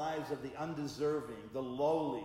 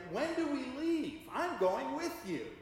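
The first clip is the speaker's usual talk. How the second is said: much higher and louder, pressing the question; wife pressing her husband, higher and more insistent; insistent wise wife; 12 semitones higher, 5 dB louder than usual. Retold or imitated. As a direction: repeat it, now insisting. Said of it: "She said again".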